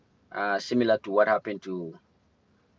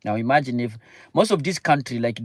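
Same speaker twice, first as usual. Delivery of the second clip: normal talking voice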